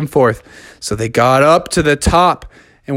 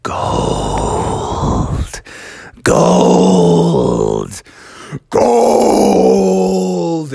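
gold-struck bear; 2 semitones higher, much slower than usual. Acted. Brother Bear transfixed by the treasure, drawn-out greedy growl rising. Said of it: "and he said".

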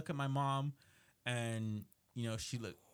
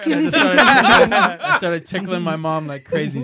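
laughing; 4 semitones higher, 18 dB louder than usual. Real, not acted. Laughing while retelling tickling.

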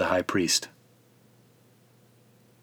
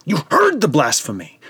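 shrieking high, loud outrage; screaming; 5 dB louder than usual. A narrator's reading. High priest screams at the council after the blasphemy.

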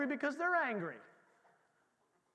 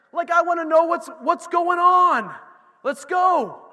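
fired up; 8 semitones higher, 12 dB louder, slower than usual. Real, not acted. Excited about battle.

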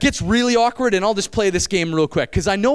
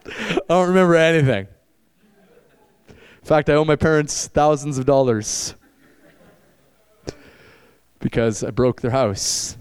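low, slow dopey drawl; mock party guy; 7 semitones lower, slower than usual. Preacher mocking a teen bragging about a party. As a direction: play a burned-out partier.

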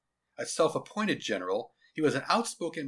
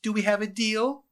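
put-on cocky kid voice; 9 semitones higher, 6 dB louder than usual. Boy pushing bargain.

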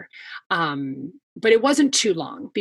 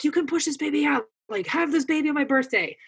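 lightly voiced husband, pitched higher; urging husband; 8 semitones higher than usual. Husband to wife in labor on his birthday, urging her.

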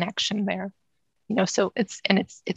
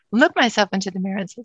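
normal talking voice

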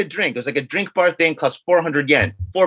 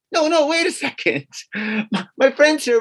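higher, agitated pleading; flustered protest; 7 semitones higher than usual. Retold or imitated. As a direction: push back, rattled but polite. Protesting to the waiter.

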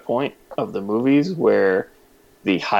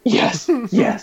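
high, delighted double yes; excited delight; 11 semitones higher than usual. Real, not acted. Fan reacting to the Tokyo Drift cut, excited delight. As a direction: cheer the moment with a high-pitched yes.

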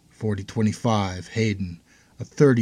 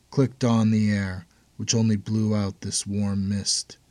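plain reading voice

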